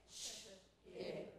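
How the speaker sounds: ordinary talk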